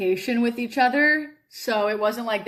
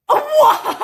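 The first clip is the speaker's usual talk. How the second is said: much higher, loud laughing shout; shocked disbelief; 10 dB louder than usual. Retold or imitated. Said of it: "But I was like".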